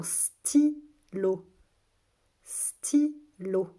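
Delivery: slow, loud, separated syllables. Shown as a demonstration. Teacher has children listen for A, syllable by syllable. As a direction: sound the word out in two beats. Teacher sounding out word for pupils.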